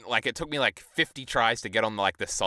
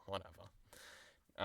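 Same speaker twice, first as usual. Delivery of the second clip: much softer, slow, throwaway; dismissive shrug; 2 semitones lower, 21 dB quieter, much slower than usual. Retold or imitated. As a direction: let it fall away, couldn't care less. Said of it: "I was like".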